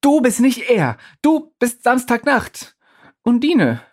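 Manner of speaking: high, loud, insistent pleading; urgent calling. Acted. Pablo insisting to Undine who she is, high and loud.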